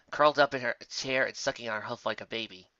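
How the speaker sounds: ordinary delivery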